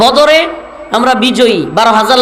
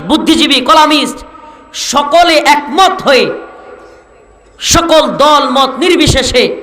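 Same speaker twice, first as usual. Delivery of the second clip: raised pitch, oratorical list; rousing preaching; 5 semitones higher than usual. Real, not acted. Preacher tells the crowd all united against oppressors, rousing high-pitched oratory. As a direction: preach it high, phrase by phrase, drawing out the ends.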